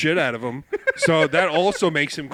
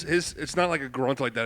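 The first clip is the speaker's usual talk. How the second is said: usual speaking voice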